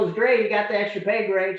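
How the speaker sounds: loud and high, bursting with it; excited news. Retold or imitated. Son telling his mom about the recruiter, loud excited burst. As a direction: blurt the good news all at once.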